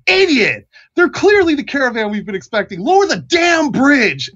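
high, angry tower-guard yell; irate, high-pitched bellow; exasperated second guard. Acted. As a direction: holler the order across at a fool. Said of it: "you hear another voice from the other tower".

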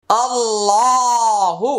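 আল্লাহু high and long, heavy lam, quick hu; heavy Allahu. Shown as a demonstration. Teacher modelling Allahu with a short final hu. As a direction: draw out আল্লাহু, clip the hu. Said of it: "এটা তাড়াতাড়ি করতে হবে".

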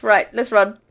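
normal talking voice

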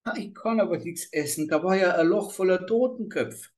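put-on a scared child begging off; frightened child. A narrator's reading. Girl tells the witch what she saw, pleading.

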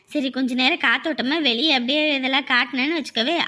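usual speaking voice

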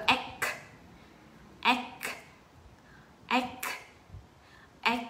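"ek" ending on a released k, no s; K sound first. Shown as a demonstration. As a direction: stop "ek" on a crisp k. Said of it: "chúng ta cứ phát âm K trước". ("ek" bật âm K cuối)

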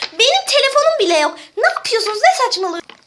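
high, incredulous outburst; agitated, high-pitched objection; exasperated protest. Acted. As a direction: demand to know what they're doing. Protesting amid acted panic.

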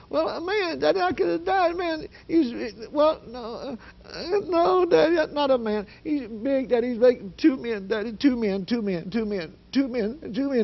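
high, stammering imitated panic; scared, shaking daughter. Shaken daughter trying to describe the man to her father, high panicked stammer. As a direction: trip over the words, repeating them frantically. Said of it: "she's still shaking like this. Her voice".

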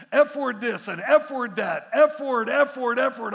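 loud, high rapid-fire punchy repetition; loud mock ranting. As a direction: belt it out like an imitated tirade. Acting out son's repeated swearing report.

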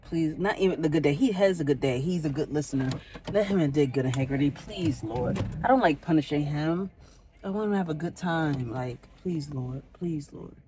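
low, soft hurried prayer; mother praying softly for her son before school. Real, not acted. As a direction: plead quietly with the Lord.

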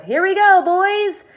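loud, high-pitched jubilant yelling; whooping, high and loud; excited shouts. A narrator's reading. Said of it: "mounting excitement and shouts of".

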